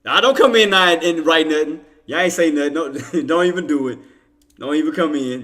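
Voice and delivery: wag a finger at chat, all in fun; mock scolding. Streamer playfully scolds chat for late comments, loud mock telling-off.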